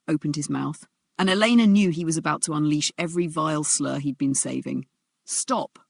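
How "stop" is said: strong clear stop. Acted. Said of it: "Strong, clear, cutting through the tension".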